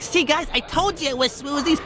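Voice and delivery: high mimicked bragging to friends; high-voiced told-you-so; excited fan. Retells the fan to her friends.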